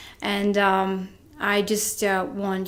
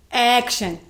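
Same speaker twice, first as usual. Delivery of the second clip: director's call; 3 semitones higher than usual. Retold or imitated. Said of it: "When the director said".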